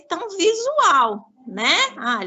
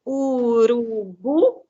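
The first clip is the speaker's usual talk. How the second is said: "Urubu" very slow, in long syllables u-ru-bu; "Urubu" drawn out while filling in the letters; 2 semitones lower, much slower than usual. Shown as a demonstration. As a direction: dictate "Urubu" slowly, stretching each vowel. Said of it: "Então, vamos completar?".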